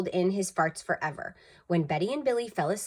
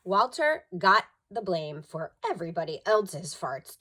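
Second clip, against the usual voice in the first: ordinary delivery, nothing marked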